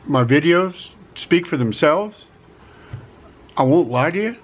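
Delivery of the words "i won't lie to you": normal talking voice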